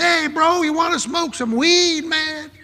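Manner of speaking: high, slow laid-back dude voice; high, slow imitation of a stoner dude; stoner offer. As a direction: offer the weed like a mellow stoner. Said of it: "He said".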